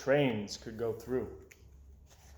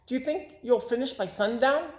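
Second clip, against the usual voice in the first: put-on higher, eager child's question; little boy voice; 8 semitones higher than usual. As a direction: lightly play a curious small boy. Little boy asks Mike.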